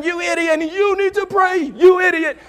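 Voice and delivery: high mock shouting match; hammed-up quarrel between two drivers; yelling back and forth. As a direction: shout insults in a strained high voice. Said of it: "the guy's yelling at him".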